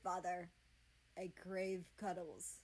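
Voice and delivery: lightly voiced crow grumbling; understated grumbling bird. A narrator's reading. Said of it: "the crow grumbled".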